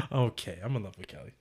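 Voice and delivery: very soft, low admission; mock confession. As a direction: murmur the confession as a quiet aside.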